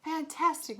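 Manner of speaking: high, loud emotional echo. Acted. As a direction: echo it, overcome. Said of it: "so emotional she could barely talk".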